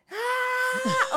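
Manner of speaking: high short cry of falling; sudden high "ah" of a fall; mock falling scream. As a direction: yelp as if the floor gave way. Falling into the hole.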